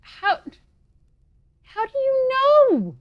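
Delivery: very high, loud and slow; shrill drawn-out disbelief. Acted. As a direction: stretch it out in disbelief. Repeats, even more startled.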